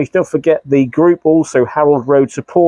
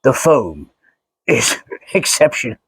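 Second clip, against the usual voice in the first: lightly lowered, hesitant Vader try; half-hearted Vader voice that breaks into a laugh; Darth Vader impression; 3 semitones lower than usual. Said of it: "do a Darth Vader impression at the same time".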